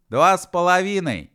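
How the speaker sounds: high, loud yell; piping little-man shout; shouted count. A narrator's reading. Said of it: "выкрикнул человечек".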